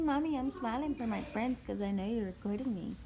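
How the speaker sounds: usual speaking voice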